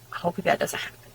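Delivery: whispered hopeful aside. Real, not acted. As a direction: breathe it under your breath, hoping. Hopeful aside after a laugh.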